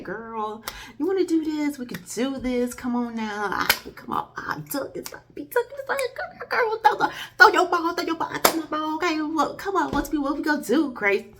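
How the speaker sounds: high, eager pretend snowball challenge; playful urging. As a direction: egg the girl on to play. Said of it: "And I would've been like".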